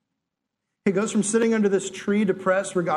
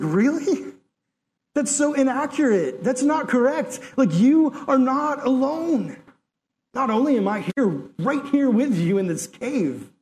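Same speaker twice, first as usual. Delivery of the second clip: higher, playfully incredulous correction; God's mock surprise; 5 semitones higher than usual. God correcting a lonely Elijah, higher and playfully incredulous. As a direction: correct him with amused disbelief. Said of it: "And God's like".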